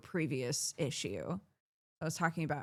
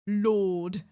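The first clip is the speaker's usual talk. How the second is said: English Lord; 2 semitones higher, 6 dB louder than usual. Shown as a demonstration. Imitating how English people say Lord, English accent. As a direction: Lord said lawd, no r, louder. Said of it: "I think they're saying".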